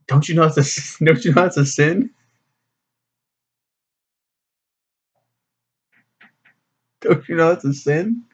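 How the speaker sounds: put-on a light parody of a scolding boyfriend; mock preachy Nick. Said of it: "And he's like".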